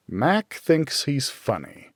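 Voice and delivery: plain reading voice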